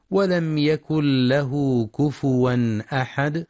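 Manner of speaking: slow measured tajwid chant; Quran recitation. Shown as a demonstration. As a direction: intone the verse slowly.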